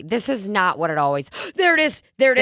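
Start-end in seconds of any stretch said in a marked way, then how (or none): 1.3-2.4 high-pitched, excited outburst after a gasp; relieved discovery. Real, not acted. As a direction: blurt it out high, you've finally found it. Finds the feared-lost logo at last.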